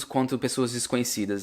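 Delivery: usual speaking voice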